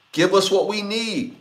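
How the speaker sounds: normal talking voice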